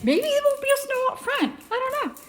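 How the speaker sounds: high little pet voice; voicing the dog. Owner voicing a reluctant dog at the door, high-pitched. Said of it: "And it's like".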